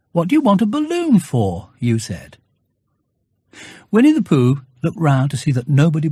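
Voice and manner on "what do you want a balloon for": put-on boy's greeting. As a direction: answer like a small boy. Boy asks.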